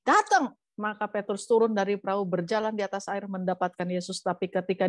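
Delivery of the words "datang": high, loud one-word summons; raised, emphatic acted command; commanding Lord. A narrator's reading. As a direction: call him over firmly. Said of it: "waktu Tuhan bilang gini".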